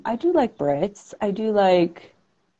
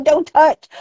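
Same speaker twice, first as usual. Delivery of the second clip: high, louder incredulous repeat of the warning; mock indignation; 8 semitones higher, 5 dB louder than usual. Speaker scoffing at what the sign says, indignant and high. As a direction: throw the words back, pitched up and loud.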